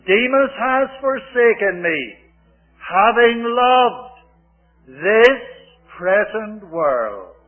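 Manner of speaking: loud, drawn-out scripture quote; ringing pulpit voice; forceful preaching. Real, not acted. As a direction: declaim it slowly to the hall.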